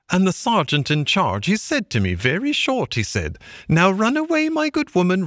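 high-pitched retelling voice; Mole's excited tale. Acted. Mole recounting the stoat sergeant, pitched high. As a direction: relish every word of the trick.

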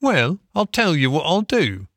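high, lilting workman's voice; good engine driver. A narrator's reading. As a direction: offer help to someone in trouble. Engine driver makes offer.

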